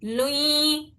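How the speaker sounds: لوئی with a nasal hum on the ل; nasal ghunna. Shown as a demonstration. Teacher shows students the nasal لوئی sound. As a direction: hum لوئی through the nose. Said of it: "لوئی کیسی ہوتی ہے آواز؟".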